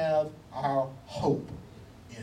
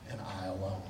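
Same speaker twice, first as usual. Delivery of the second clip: hushed; 11 semitones lower, 14 dB quieter than usual. Acted. Hushed close of quote.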